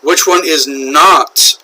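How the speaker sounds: not stressed, slow and drawn out. Shown as a demonstration. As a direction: lean on the word not. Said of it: "emphasis on the not here".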